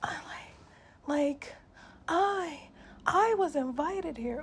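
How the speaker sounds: mocking entitled woman. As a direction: mimic her loud and whiny, dragging it out. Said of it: "she kept saying it like".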